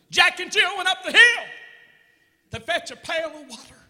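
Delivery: mock child preacher; brother preaching. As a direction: preach a nursery rhyme with fervor. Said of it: "start off the church service by preaching".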